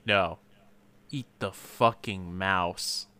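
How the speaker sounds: low, soft, slow order; understated controlled anger; mock quiet menace. Acted angry husband giving order.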